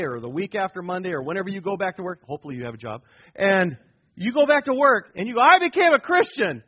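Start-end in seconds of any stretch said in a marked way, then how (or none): 5.4-6.6 loud, high, proud announcement; excited announcement. Retold or imitated. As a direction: blurt it out to coworkers with joy. Voicing yourself at work.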